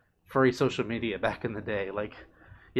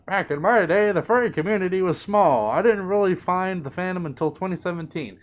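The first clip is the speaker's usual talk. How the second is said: higher, louder mock old-timer grumble; grumpy old-timer; 6 semitones higher, 5 dB louder than usual. Reading Joey's chat as a hammed-up old-timer. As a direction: ham up an old furry reminiscing.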